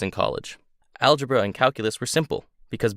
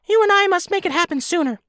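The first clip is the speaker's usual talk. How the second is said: very high, squeaky little creature voice; squeaky helium voice; 4 dB louder than usual. Acted. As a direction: talk like you sucked a helium balloon. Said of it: "in his squeaky helium balloon voice".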